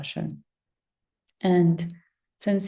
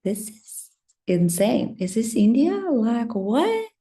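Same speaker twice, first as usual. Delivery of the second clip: amazed disbelief; 4 semitones higher, slower than usual. Retold or imitated. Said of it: "I was like".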